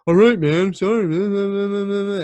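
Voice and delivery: high whiny apology dissolving into babble; mock apology. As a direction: imitate a guy apologizing, dragging out the last words. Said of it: "he just apologized".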